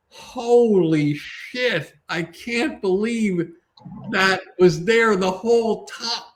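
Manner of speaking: high, slow, awestruck gasp of disbelief; stunned amazement. Retold or imitated. Comedy coach voicing an amazed audience member, stunned and high. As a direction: act out the jaw-drop of an audience. Audience shocked at twist ending.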